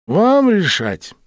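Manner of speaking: mildly indifferent, stretched out. A narrator's reading. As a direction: leave it to them, slowly and indifferently. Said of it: "равнодушно сказал водитель".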